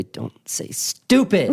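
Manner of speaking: high, slow mock toddler voice straining to say it; imitating toddler. Dad imitating his daughter sneaking the forbidden word in, slow high toddler voice. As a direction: strain the words out slowly like a little kid bursting to say it. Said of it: "she'd say".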